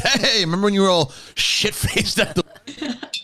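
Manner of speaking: high, slow, laughing dad voice; lightly voiced laughing dad; teasing dad. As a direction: tease like a proud dad. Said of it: "he's like".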